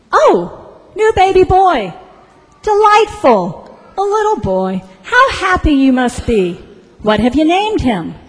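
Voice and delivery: high, loud, swooping congratulations; hammed-up effusive registrar, high and loud; delighted gushing. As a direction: gush loudly over the new baby. Registrar gushes, echoing the earlier clerk.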